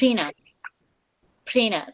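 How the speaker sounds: প্রিনার said "prinar" with no t; vanishing t. Shown as a demonstration. Teacher repeats প্রিনার for the class, "prinar" with no t. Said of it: "vanishing টি".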